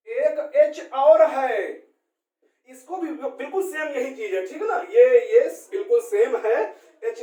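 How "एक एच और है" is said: slow emphatic repeat. Shown as a demonstration. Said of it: "teacher repeats slowly".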